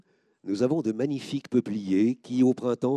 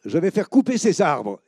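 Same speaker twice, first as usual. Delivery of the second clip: raised, loud exasperated threat; angry husband; 7 semitones higher, 7 dB louder than usual. Retold or imitated. Husband annoyed by the trees, loud and angry. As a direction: snap it out like a fed-up husband. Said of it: "il s'énerve".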